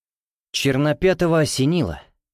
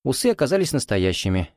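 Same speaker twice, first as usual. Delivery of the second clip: plain reading voice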